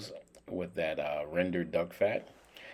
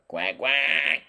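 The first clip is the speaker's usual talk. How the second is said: higher, stretched-out quack; duck quack; 6 semitones higher, 4 dB louder than usual. Pretend. Cook playfully quacking at his roast duck, imitated quack.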